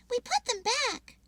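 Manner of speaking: high little squirrel; confident squirrel plan. Acted. Pip squirrel voice.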